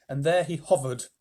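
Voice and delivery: plain reading voice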